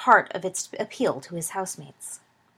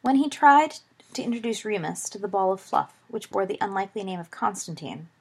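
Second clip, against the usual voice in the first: usual speaking voice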